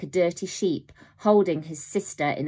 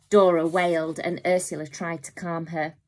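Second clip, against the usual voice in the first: ordinary delivery, nothing marked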